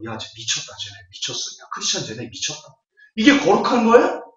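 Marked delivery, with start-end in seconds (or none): 0.0-2.6 softer, half-whispered aside; bystanders' mutter. Retold or imitated. Outsiders whisper about laughing Christians, a soft mocking mutter. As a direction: mutter it like onlookers trading remarks. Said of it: "일반 사람들이 보면 뭐라고 말해요".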